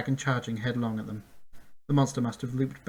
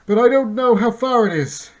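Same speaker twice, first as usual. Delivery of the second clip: loud, higher call; called back; 11 semitones higher, 14 dB louder than usual. A narrator's reading. Chester answering Bink while galloping, a loud call. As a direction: shout back in irritation. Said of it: "Chester called back".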